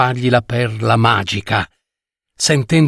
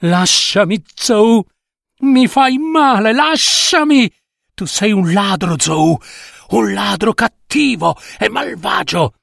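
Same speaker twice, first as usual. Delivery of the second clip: much higher, pained and desperate; frightened protest; 6 semitones higher than usual. Acted. Old mother Nie struggling against Zhou's grip, frightened cries. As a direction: fight him off in panic.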